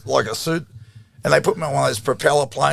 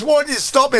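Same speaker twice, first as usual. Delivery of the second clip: incredulous; 12 semitones higher, 3 dB louder than usual. Retold or imitated. Roommate's retort, acted high.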